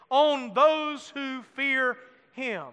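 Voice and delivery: On those who fear him, every word slow and weighted; slow and deliberate. Shown as a demonstration. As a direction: lead the congregation through it slowly. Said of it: "Say that phrase with me".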